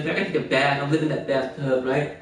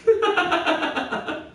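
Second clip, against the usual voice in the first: high laughing fit; 13 semitones higher than usual. Real, not acted. Cracks up again.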